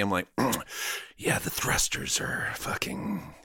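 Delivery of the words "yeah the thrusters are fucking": underplay it like a smoky, mumbling film star; cool, husky tough-guy line read; De Niro, smoky. Acted. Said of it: "I'm fucking De Niroing this shit".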